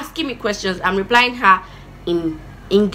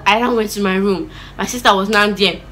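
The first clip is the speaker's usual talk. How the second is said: usual speaking voice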